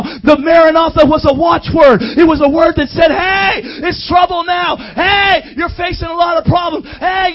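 loud, driving sermon delivery; fired-up preaching. Real, not acted. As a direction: preach it hard to rouse the congregation. Preaching at full force.

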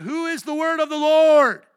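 high, booming shout to the congregation; full-force pulpit yell; preacher's shouted challenge. Real, not acted. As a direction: roar the question, stretching the last word. Preacher demanding congregation answer.

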